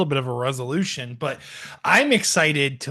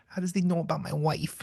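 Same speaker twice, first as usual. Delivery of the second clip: light, quick Mike Tyson imitation, much softer; Mike Tyson impression; 8 dB quieter, faster than usual. Co-host lightly imitating Mike Tyson reacting to the podcast. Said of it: "your Mike Tyson impression".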